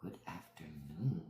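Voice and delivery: whispered greeting, no voice. Shown as a demonstration. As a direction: breathe the greeting out softly.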